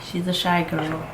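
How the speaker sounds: normal talking voice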